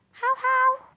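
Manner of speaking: high yappy barks for the dog; playful pet barks, voicing the dog. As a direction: bark it out like a little dog.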